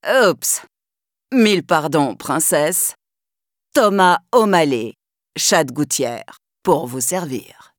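low, suave tomcat; alley cat charmer. Acted. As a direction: lay on the gallant charm.

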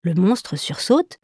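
usual speaking voice

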